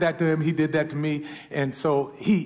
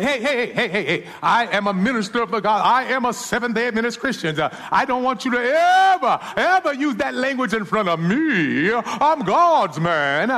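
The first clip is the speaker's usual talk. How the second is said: louder, higher, hammed-up preacher scolding; mock stern rebuke; 7 semitones higher, 6 dB louder than usual. Minister imagining rebuking the shoe shiner, mock stern and loud. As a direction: pretend to dress him down, slowing on the last line. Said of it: "I could have said".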